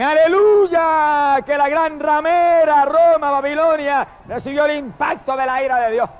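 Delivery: high, stretched cry carried on through the verdict; ringing pulpit shout, easing at the end; shouted hallelujah. Retold or imitated. Preacher voices heaven rejoicing over Babylon's fall, high shout. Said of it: "dice que en el cielo gritan".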